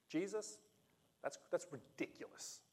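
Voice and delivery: dry, sarcastic disbelief fading to a breath. Retold or imitated. Disciples scoffing at Jesus, sarcastic and breathy. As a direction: scoff at the idea. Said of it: "The sarcasm is lost here".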